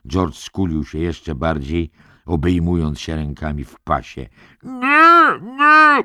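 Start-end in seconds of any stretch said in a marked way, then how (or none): 4.6-6.0 very high, loud panicked scream. A narrator's reading. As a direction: scream it in terror. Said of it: "krzyknął". (przerażony, wysoki krzyk)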